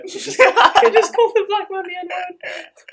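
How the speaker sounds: stretched-out, high, loud fake crying; hammed-up anguished cry; mock wail. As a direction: wail it like the end of the world. Mock despair over friend's slur.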